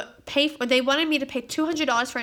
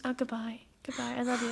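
soft goodbye; 11 dB quieter than usual. Retold or imitated. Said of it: "I'm just".

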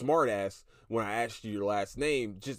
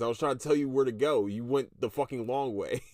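ordinary talk, nothing marked

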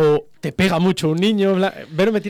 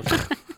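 high burst of laughter; 13 semitones higher, 4 dB quieter than usual. Real, not acted. Laughs at being live.

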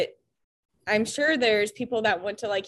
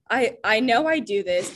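ordinary talk, nothing marked

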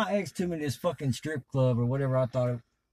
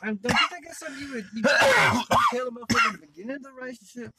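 mock outraged question; 11 semitones higher, 4 dB louder than usual. His own retold outraged reply.

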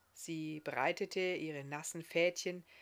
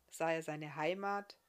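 ordinary delivery

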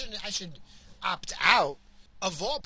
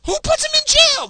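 the same question screamed, far higher and louder; furious shout; 14 dB louder than usual. Real, not acted. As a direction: explode on the repeat, full yell. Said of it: "Yeah, I'm worked up".

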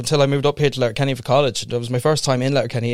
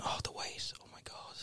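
whispered, much softer; whispered groan; 25 dB quieter than usual. Real, not acted. Moaning to his mate about the wait, whispering. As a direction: drop to a weary whisper.

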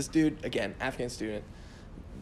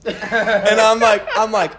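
loud, high burst of laughter through the words; laughing; 8 semitones higher, 12 dB louder, slower than usual. Real, not acted. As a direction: laugh it out loud, much higher and louder. Laughing at own blunder.

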